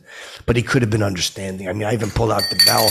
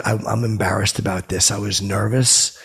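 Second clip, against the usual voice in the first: normal talking voice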